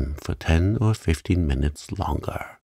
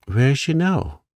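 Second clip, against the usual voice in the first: interested; 8 semitones higher than usual. A narrator's reading. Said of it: "much interested".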